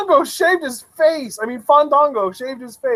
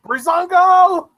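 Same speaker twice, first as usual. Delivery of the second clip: higher, stretched-out hype cheer; excited call; 7 semitones higher, 3 dB louder than usual. Real, not acted. Fan announcing the tag team's arrival, excited.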